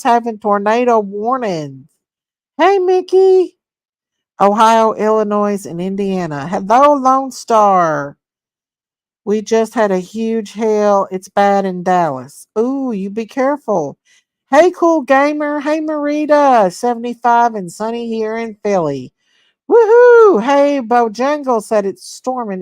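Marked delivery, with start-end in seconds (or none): 2.6-3.6 high, drawn-out sing-song hello; high sing-song greeting. Real, not acted. Greeting a chat viewer by name, high sing-song. 19.7-20.4 high loud whoop; excited cheer. Real, not acted. Cheering at a viewer's sunny weather, high loud whoop.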